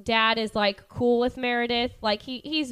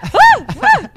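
much higher, much louder mock screaming; imitated scream; 14 dB louder than usual. Podcaster imitating Meredith seeing the twins, shrieking. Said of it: "She's like".

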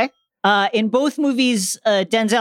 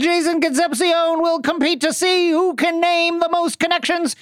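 1950s boxing announcer; 5 semitones higher than usual. Acted. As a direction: introduce the fighters. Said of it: "a nineteen fifties boxing announcer".

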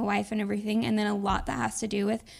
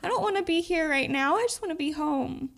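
desperate longing; 6 semitones higher than usual. Retold or imitated. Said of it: "all I was feeling was like".

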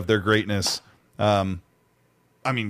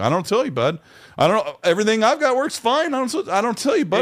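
high-pitched mock condescension; condescending; 8 semitones higher than usual. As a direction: brag smugly in a put-on high voice. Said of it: "our really condescending statement we use".

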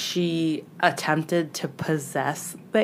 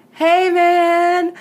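high, loud mock holler; imitated friendly call; 11 semitones higher, 6 dB louder than usual. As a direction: call out to a pal across the way. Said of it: "his roommate suddenly yelled at him".

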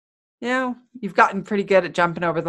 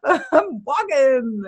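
higher, slow, overwrought "I'm walking"; mock stressed drama, slowed; 6 semitones higher, much slower than usual. Stressed-out walker making drama of walking, in slow mock anguish. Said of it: "I'm making fun".

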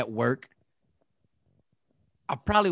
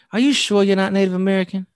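higher, louder imitated questioning; pressing disbelief; 5 semitones higher, 7 dB louder, faster than usual. Nosy stranger questioning his heritage, insistent disbelief. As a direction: press him as if doubting his answer.

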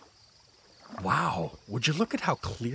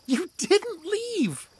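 high, drawn-out exclamation of surprise; surprised; much slower than usual. Acted. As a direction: gasp it out in disbelief. Surprised to see Amoebi again.

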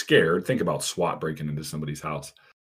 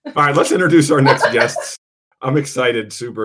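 excited; 8 semitones higher, 10 dB louder than usual. Real, not acted. Excited host intro with laugh.

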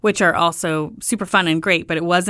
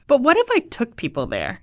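higher, lightly voiced hopeful version of herself; hopeful idea; 6 semitones higher than usual. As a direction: float the idea to the husband eagerly. Wife pitching idea to husband.

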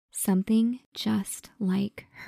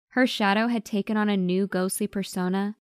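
plain reading voice

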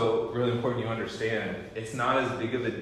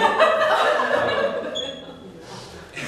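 high wheezing laugh; 11 semitones higher, 9 dB louder than usual. Real, not acted. Laughs after the punchline.

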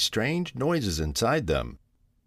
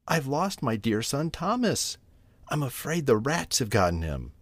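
higher at first, softly lamenting; a worried mother's gentle lament; mildly tearful; 6 semitones higher, 4 dB quieter than usual. A narrator's reading. Tabitha tells her cousin about lost Thomas, mildly tearful. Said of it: "shedding tears".

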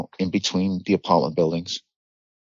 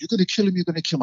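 put-on much higher, frantic voice of scared kids; panicked high pleading; 12 semitones higher, much faster than usual. Scared kids begging him to stop choking their friend, frantic and high. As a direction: yelp it up high like a terrified teenager.

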